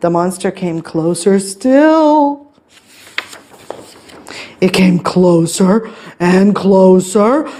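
slow, stretched storytelling; building suspense. A narrator's reading. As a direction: draw out the creeping approach. Suspenseful narration.

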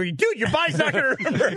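high, laughing retort. Retold or imitated. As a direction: laugh through the disbelief. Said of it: "I go".

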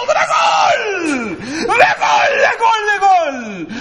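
screaming goal like a commentator; goal call shout. Pretend. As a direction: yell the goal call at full pitch. Mock football commentary goal call.

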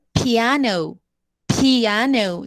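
piano, I drawn out; longer I. Shown as a demonstration. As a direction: stretch the I in piano, end on a U. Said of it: "se alarga un poquito más la I que la A".

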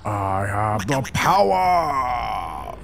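very slow, drawn-out proclamation; mock-epic power-up call; heroic battle cry. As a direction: stretch it out like a cartoon hero. Acting a heroic catchphrase.

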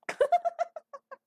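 high, loud squeal of laughter; burst of laughter. Real, not acted. Laughing at herself for changing the color, high squeal of laughter. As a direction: laugh out at your own slip.

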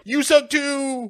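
very high, drawn-out mock yell; yelled parting shot. Milo leaves the alien who saved him, mock yelling. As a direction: call it back, stretching each word high.

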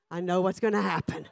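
normal talking voice

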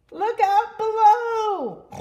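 high, loud, drawn-out holler; gleeful diver's shout; calling out a warning. Acted. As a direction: yell it long from up high. Pig calls out as it dives.

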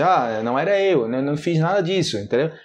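usual speaking voice

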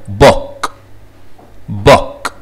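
بوك with a brief single-O vowel; short double O. Shown as a demonstration. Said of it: "وان O". (بوك بحركة قصيرة)

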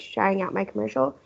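usual speaking voice

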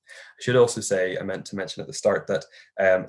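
usual speaking voice

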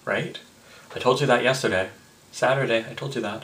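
usual speaking voice

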